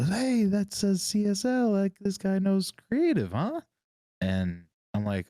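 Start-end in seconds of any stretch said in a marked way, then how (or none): 0.1-3.6 imitating a buddy. Retelling a buddy in an elevator, high mock voice. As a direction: play the friend in a high silly voice. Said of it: "my buddy Ryan goes".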